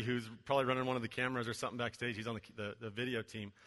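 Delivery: normal talking voice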